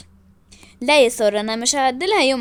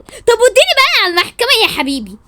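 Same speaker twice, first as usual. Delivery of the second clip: loud, high challenge; indignant demand; 7 semitones higher, 6 dB louder than usual. Acted. Wife tells husband take me to court, shouting. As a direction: demand it furiously.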